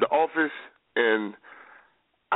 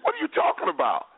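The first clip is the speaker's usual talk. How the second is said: pitched way up in disbelief; can't believe what he's hearing; incredulous. Real, not acted. Co-host doubts the player, incredulous disbelief. Exasperated debate retort.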